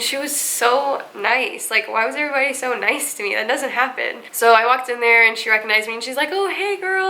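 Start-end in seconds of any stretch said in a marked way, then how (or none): 6.3-7.1 much higher, cheery imitated hello; chirpy greeting. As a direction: mimic the staffer's bright greeting. Staff greets her cheerfully.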